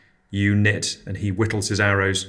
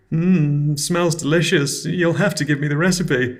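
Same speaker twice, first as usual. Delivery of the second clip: fake politeness; 7 semitones higher than usual. Forced polite praise of gruel.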